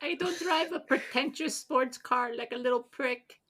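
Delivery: high tiny falsetto; little guy voice. Acted. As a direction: squeak indignantly as the little guy. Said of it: "your best little guy voice".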